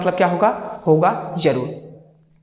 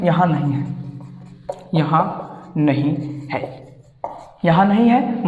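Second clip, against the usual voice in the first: put-on child's reply; 2 semitones lower, slower than usual. Teacher acts child answering mother.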